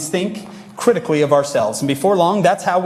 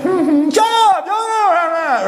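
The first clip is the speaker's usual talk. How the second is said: much higher, louder yelling shut up; little brother yelling; 3 dB louder, much slower than usual. Retold or imitated. Little brother snaps at complaining sibling, high shrieking yell. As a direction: yell at your sibling like a bratty kid. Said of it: "your little brother's like".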